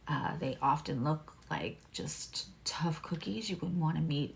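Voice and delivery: very soft, near-whispered aside; confidential hush. Real, not acted. Tarot reader confiding a secret about Scorpio to viewers, hushed. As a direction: let them in on a secret, keeping it low.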